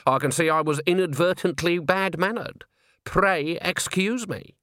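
sweeten it with menace; icy politeness. Acted. Granny's icy apology.

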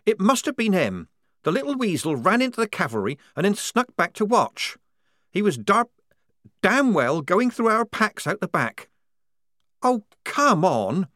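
high, loud indignant accusation; angry young soldier girl. Acted. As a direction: spit out the blame. Polly voiced angrily.

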